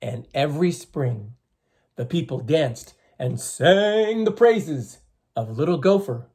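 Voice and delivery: slow, expressive storyteller voice; story reading for children. A narrator's reading. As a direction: read the legend to the class, drawn out. Teacher reading legend to children.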